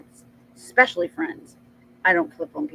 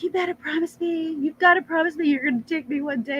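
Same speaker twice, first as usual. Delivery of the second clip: daughter pleading; 8 semitones higher, faster than usual. Retold or imitated. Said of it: "she's like".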